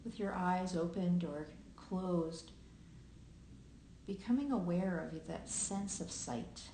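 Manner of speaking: unhurried meditation guiding with long pauses; guided meditation. Acted. Host guiding listeners into present awareness, calm meditation voice with long pauses. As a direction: lead listeners gently through their senses, leaving long silences.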